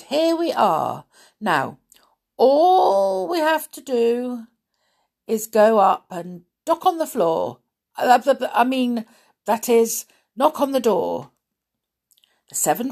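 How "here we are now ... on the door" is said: a fussy little dwarf leader; bumbling, tripping over words; flustered dwarf. A narrator's reading. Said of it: "Doc said".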